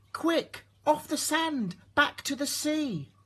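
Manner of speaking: high urgent snail cry. A narrator's reading. Snail urges the beached whale back to sea, high cry. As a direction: call out in alarm, tiny and high. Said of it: "cried the snail".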